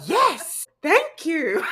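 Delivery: high gasp, then a loud laughing yes; thrilled, laughing thanks; excited gasp. Retold or imitated. Speaker relives a client booking, gasping and laughing with joy.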